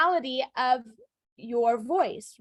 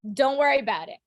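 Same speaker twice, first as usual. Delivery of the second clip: firm, louder brush-off with a falling end; standoffish; 5 dB louder, faster than usual. Shown as a demonstration. Teacher shows shutting someone off, standoffish. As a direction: say it as if mad at them. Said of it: "It's very standoffish if you say it that way".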